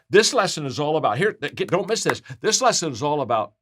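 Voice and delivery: usual speaking voice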